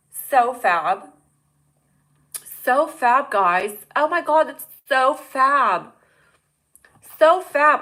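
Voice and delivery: hammed-up camp gushing.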